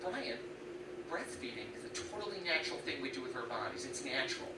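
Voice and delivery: soft, insistent lecturing woman; lightly voiced offended woman, quiet and firm; a hint of a stern woman. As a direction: lecture him softly, insisting. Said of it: "She's like".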